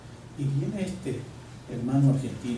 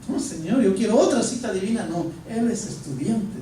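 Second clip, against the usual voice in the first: higher, louder reluctant pushback; protesting; 6 semitones higher, 3 dB louder than usual. Retold or imitated. Pastor protesting to God's voice, higher and reluctant. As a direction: argue your way out of the task. Arguing back with God.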